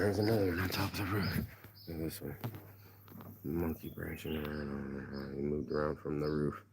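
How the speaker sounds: very soft, low monkey-watching commentary; hushed aside. Real, not acted. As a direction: drop low and quiet, as if spotting wildlife. Quiet aside watching monkeys on roof.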